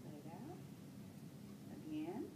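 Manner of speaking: low, soft, unhurried breathing cues; steady bedside voice for deep breaths; calm breathing coaching. Acted. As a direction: guide each breath in and out gently. Nurse coaches patient's deep breathing.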